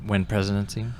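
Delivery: ordinary talk, nothing marked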